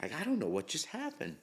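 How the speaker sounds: high, hushed bewilderment; a quiet, high-pitched what-just-happened; stunned disbelief. Retold or imitated. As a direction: mutter it high and dazed, half to yourself. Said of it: "and I'm like".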